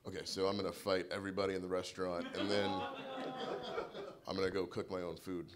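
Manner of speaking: mock-calm explanation. Calmly explaining plan to officer.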